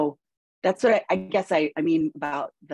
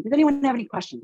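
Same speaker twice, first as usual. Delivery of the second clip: upbeat teacher question; 8 semitones higher, 3 dB louder, much faster than usual. Retold or imitated. Teacher asks lecture hall.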